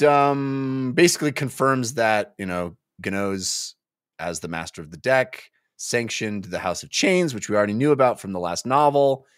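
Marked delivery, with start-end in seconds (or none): none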